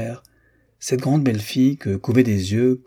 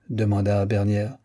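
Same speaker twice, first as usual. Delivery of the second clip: plain reading voice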